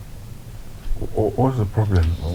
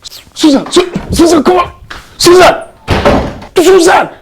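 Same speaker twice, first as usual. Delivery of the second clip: very high, loud, urgent shouts; shouting a name; 8 dB louder than usual. Acted. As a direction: yell after someone walking out on you. Father shouts after daughter storming off.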